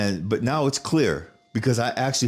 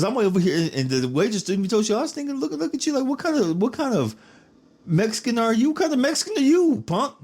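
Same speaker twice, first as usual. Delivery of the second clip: very high-pitched imitation of an incredulous relative; mock indignation. Wife's relative ribbing him about sopapillas, high mock indignation. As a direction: squeal the teasing challenge in mock outrage. Kinswoman teasing him at restaurant.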